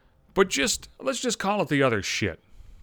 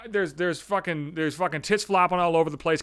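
normal talking voice

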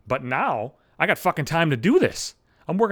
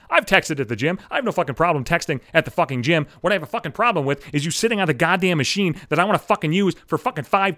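normal talking voice

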